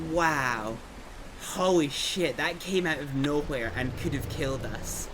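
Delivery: ordinary delivery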